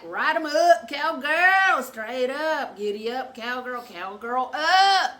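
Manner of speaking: loud, high mock cowboy egging-on; imitating husband's rowdy hollering. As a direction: holler it like a rodeo rider. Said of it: "he hollered it out".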